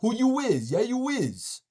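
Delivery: hammed-up imitation of someone talking tough; mocking street voice. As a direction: sneer it like a belligerent youngster. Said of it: "talk like".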